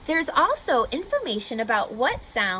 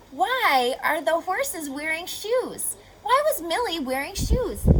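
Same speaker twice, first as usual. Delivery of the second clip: wondering question; 6 semitones higher than usual. Retold or imitated. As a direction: ask it like a puzzled child. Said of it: "one of my questions was".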